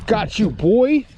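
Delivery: taunting. Real, not acted. Taunting the hooked fish.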